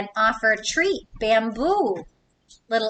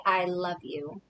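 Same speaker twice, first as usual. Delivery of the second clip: soft, lower i love you; tender i love you; 5 semitones lower, 6 dB quieter than usual. Acted. Reading to kids, the whale saying it tenderly. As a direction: breathe it softly to a loved one.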